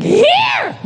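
one very high rock screech. Acted. Rock singer as a schoolkid answering roll call, screeched. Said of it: "having a voice like that".

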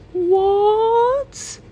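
high, loud incredulous what; shocked. Real, not acted. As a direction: react in disbelief. Shocked reaction to verse.

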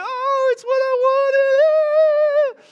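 high, slow, sung-out squeal of delight; kids' delight. Retold or imitated. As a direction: sing it like a thrilled child. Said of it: "they'd be like".

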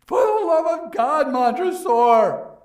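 shrieked high and loud; a doomed man's last scream; desperate scream. Acted. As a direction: cry out for your life. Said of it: "walled-in victim's final plea".

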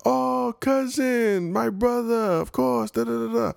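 overdone shopkeeper greeting, high and slow; hammed-up shopkeeper. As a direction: drawl a fawning welcome up high. Shop owner greets him.

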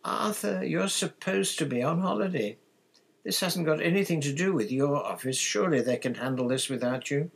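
high, plaintive pleading; upset. A narrator's reading. Wife protesting husband leaving on holiday, upset. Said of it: "Missus Weasley looked most upset".

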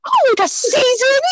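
high-pitched teasing echo; mock innocent question. Mocks the friend's springy remark.

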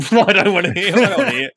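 joking brush-off with a laugh; mock rude. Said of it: "No, I'm joking".

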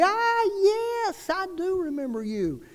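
high, lifted voice of a woman recognising him; a hint of Bobby Lee caught off guard; lightly voiced surprised woman. The preacher voicing the school nurse who remembers him, lightly and high. Old friend answers his phone call.

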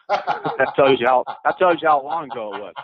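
laughing while telling it. Real, not acted. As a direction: chuckle through the words. Laughing about the old smoking days.

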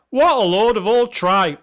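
bad Scottish accent. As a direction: attempt a Scottish accent on a scathing review. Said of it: "I would do a Scottish accent".

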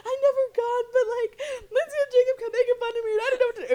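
high, loud, fast tearful-laughing confession; frantic confession. Retold or imitated. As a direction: blurt it all out in a panic. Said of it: "And then I was like".

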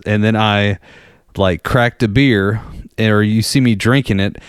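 normal talking voice

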